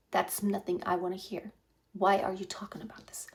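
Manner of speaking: very soft, sinking into a whisper; quiet private grumble; whispered inner thought. Retold or imitated. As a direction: murmur it to yourself. Inner reaction, "inside of myself.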